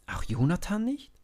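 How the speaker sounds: high, rising disbelief; can't believe it, ask high; disbelieving question. A narrator's reading. Young David doubting that even Jonathan dares not fight, astonished.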